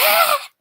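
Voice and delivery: high mock scream; a sudden high squeal of fright; short shrieked ah; retold scream. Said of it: "I screamed".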